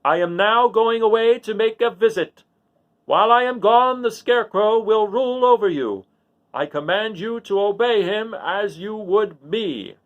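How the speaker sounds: loud, slow and high royal proclamation; the wizard ruling from the balloon basket, booming to his people. A narrator's reading. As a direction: call it out slowly to the whole crowd. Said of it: "in a loud voice".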